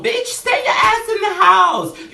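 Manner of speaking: high, loud mock scolding; self-scolding. Telling her past self off, loud high scold. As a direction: scold yourself like a fed-up friend.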